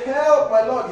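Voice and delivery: high, loud plea for help; loud, high begging call; woman crying out. Acted. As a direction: cry out to your lord. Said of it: "When the woman said".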